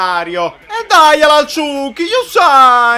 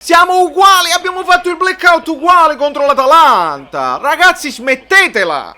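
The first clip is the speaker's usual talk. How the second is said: angry shouting; 7 semitones higher, 6 dB louder than usual. Real, not acted. Fan yelling at his team.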